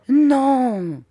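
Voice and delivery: high, loud, drawn-out exclamation; emphatic, full-voiced denial; energetic cry. A narrator's reading. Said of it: "s'écria Peggotty avec une énergie incroyable".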